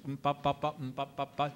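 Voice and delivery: softly vamped guitar oom-pah; imitated guitar accompaniment. Shown as a demonstration. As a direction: mimic a simple strummed accompaniment quietly. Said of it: "just a simple guitar accompaniment".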